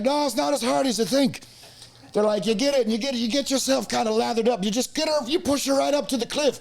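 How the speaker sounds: high hammed-up Irish lad voice; high mock Irish lad coaching. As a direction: explain it like a drunk Irish mate, high.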